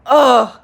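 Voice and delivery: loud groan of disgust. Real, not acted. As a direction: groan at the memory. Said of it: "makes me groan".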